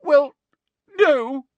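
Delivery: high, loud halting sob; sobbed. A narrator's reading. Hermione admitting ignorance, sobbed. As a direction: sob it out brokenly. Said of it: "sobbed Hermione".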